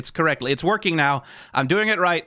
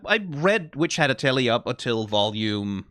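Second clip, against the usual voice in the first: usual speaking voice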